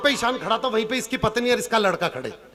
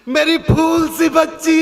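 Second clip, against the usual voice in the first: slow, drawn-out wail, louder and higher; mock crying father; 4 semitones higher, 4 dB louder, slower than usual. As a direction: ham up a father sobbing at his daughter's farewell. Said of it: "लड़की का बाप रो रहा".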